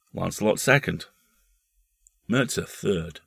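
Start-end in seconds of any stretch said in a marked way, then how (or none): none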